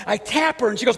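ordinary talk, nothing marked

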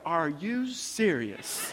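slow, laughing disbelief; drawn out with a laugh in it; incredulous. Retold or imitated. Sarah hearing Abraham call her his sister, laughing disbelief. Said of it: "Sarah's like".

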